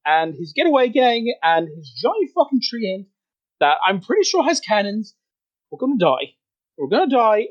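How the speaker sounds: high-pitched frantic panic in character; panicked I-told-you-so. Acted. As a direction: fret wildly at a friend who wouldn't listen. Worried creature panics as doom approaches.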